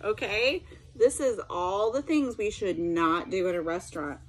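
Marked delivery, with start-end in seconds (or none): none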